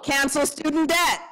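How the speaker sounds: high call to the crowd; rally the room with a prompt. Shown as a demonstration. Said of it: "calling prompts to the crowd".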